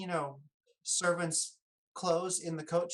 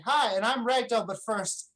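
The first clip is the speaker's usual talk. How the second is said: character voice; 6 semitones higher, 5 dB louder than usual. Acted. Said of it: "he'll start to speak up and say".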